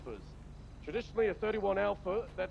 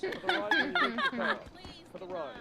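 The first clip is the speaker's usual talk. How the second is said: laughing. Real, not acted. Laughing while giving directions.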